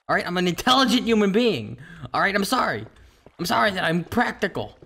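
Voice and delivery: indignant self-justification; exasperated rant. Real, not acted. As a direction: argue back, worked up and insistent. Defending how they react.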